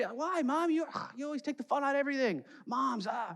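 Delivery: put-on high, fast childish whine; a kid complaining that Mom spoils everything; whiny kid. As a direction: whine like a kid denied fun.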